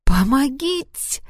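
high, drawn-out cry for help. Acted. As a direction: wail it out for help. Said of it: "я открыла рот и заголосила".

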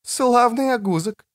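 high teasing woman's voice; mock giggly teasing. Woman eyeing the half-naked man, high teasing. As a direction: tease high, without an actual giggle. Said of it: "захихикала Креция".

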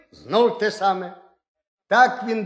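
usual speaking voice

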